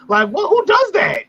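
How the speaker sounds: stunned at such cruelty; indignant disbelief. Real, not acted. Host recalling friend's cruel videos, outraged disbelief.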